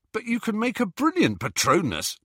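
high-pitched, incredulous protest. A narrator's reading. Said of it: "protested Ron".

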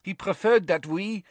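frightened prisoner hurrying his excuses; hurried and nervous. A narrator's reading. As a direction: hurry to justify yourself. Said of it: "said Karkaroff hurriedly".

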